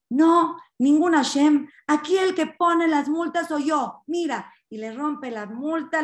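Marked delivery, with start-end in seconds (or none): 0.1-4.5 acted angry assertion, louder and higher, slow emphatic start. Policeman insists he issues the fines, angry. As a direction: lay down authority in a raised, indignant voice. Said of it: "este se enoja, agarra y dice".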